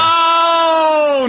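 mock dismay. Mock dismay at being blamed.

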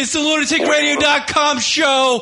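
wrestling promo. Acted. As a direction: hype the crowd, big and slow. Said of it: "let's do a promo".

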